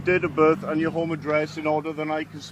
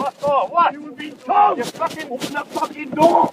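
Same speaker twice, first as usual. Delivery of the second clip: furious shout; 9 semitones higher, 8 dB louder than usual. Real, not acted. Officer orders driver out.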